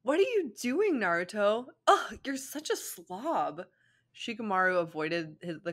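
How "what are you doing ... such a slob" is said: put-on high, disdainful girly voice; disgusted Ino. Ino mocks Naruto's slurping, high disgusted voice. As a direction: sneer at him like a snob.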